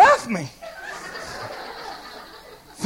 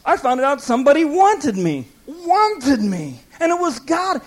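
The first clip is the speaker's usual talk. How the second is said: ordinary talk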